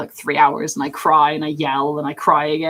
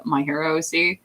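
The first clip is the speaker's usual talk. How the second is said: ordinary talk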